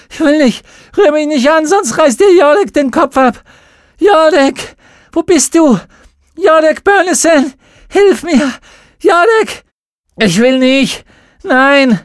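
high, loud frantic screams; desperate screaming. A narrator's reading. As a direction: scream for help with all you have. Mädchen kämpft, schreit nach Jodek. (verzweifeltes Schreien)

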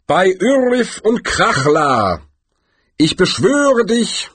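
high, slow, drawn-out incantation; Galder, invoking. A narrator's reading. Galder remembers the summoning formula, intoning it slowly. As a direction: intone it as a wizard's spell.

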